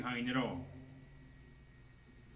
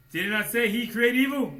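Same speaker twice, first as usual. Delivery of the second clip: loud, high, slowing on the last words; full-force preaching; 11 semitones higher, 12 dB louder than usual. Acted. Preacher urging his brethren to fight, loud high preaching. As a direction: preach it up onto the pedestal.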